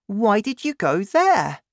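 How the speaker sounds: put-on high, loud child's question; crying out. A narrator's reading. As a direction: demand to know as an astonished boy. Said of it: "I cried".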